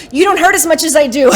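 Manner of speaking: loud mock complaint. Older player griping to twenty-year-old teammates, loud mock complaint. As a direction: protest loudly with comic indignation.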